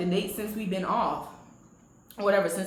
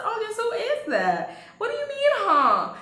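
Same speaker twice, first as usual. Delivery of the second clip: hammed-up confrontation, voice pitched way up; indignant repeat; 13 semitones higher, 4 dB louder than usual. Repeats the question, confronting him.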